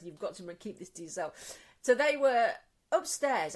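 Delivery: ordinary talk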